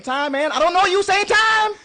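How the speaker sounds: squeaky high helium voice; loud, squealing helium chatter. Real, not acted. Helium voices trading lines.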